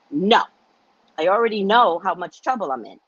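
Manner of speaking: ordinary delivery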